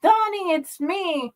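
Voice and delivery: high, urgent call that fades; pleading call. A narrator's reading. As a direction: beg him to turn round. Calling to his friend.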